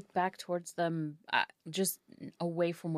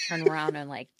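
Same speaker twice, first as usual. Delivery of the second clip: normal talking voice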